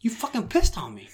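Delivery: high-pitched reenacted outrage; retold angry accusation. Man confronting friend who peed on him, retold accusation.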